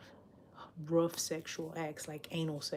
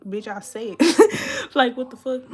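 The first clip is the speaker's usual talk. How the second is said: loud laughing outburst; 5 semitones higher, 15 dB louder, slower than usual. Real, not acted. Laughing outburst at his text.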